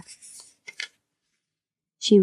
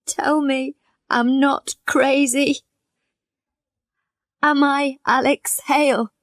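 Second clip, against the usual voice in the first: tearful plea; 5 semitones higher than usual. Acted. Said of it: "Alex was crying".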